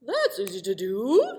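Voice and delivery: shapeshifter voice. Acted. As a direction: grant the wish with a flourish.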